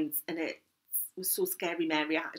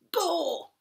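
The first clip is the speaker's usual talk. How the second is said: put-on high, bright Geordie "Go!" like a little girl; Geordie toddler shout; 10 semitones higher than usual. Grandma imitating granddaughter at the slide, Geordie accent. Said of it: "With a real Geordie accent".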